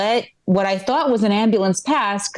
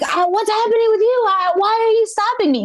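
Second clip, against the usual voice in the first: very high, frantic questions; panicked pushback; 11 semitones higher than usual. Retold or imitated. Driver answering the screaming cop, high and panicky. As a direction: squeak back in alarm.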